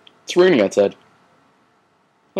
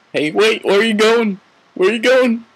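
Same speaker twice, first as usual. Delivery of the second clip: high calling voice; 11 semitones higher than usual. Acted. Character calls after someone leaving.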